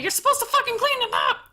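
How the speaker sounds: high, rapid mock yell; exasperated scolding. Retelling a telling-off of the nephew, exasperated mock yell. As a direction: fire it off like a fed-up relative.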